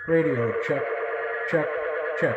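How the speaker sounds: maximum ringing echo; radio check with the echo maxed out; toy echo mic. Real, not acted. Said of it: "this is max".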